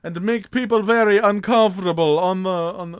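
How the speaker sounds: loud, high hammed-up Italian man; mock Italian voice. Imagining Italian Man Horse Summer, a loud hammed-up Italian voice. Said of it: "I thought it was gonna be like".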